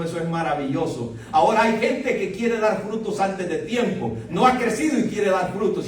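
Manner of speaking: ordinary talk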